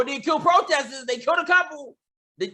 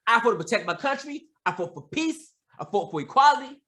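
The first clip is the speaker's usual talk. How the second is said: normal talking voice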